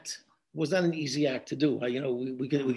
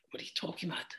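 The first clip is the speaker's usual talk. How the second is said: a stunned whisper; whispered "What are you talking about?"; whispered shock; 15 dB quieter, much faster than usual. Retold or imitated. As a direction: breathe it out in disbelief. Stunned reply to the accusation.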